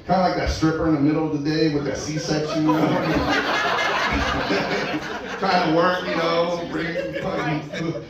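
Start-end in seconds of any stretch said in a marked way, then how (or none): 2.8-5.3 laughing. Real, not acted. Comic laughs at own joke.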